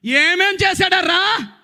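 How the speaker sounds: slow, high, loud challenge; demanding shout. Real, not acted. Preacher pressing the silent congregation, shouting. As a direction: shout the question at them.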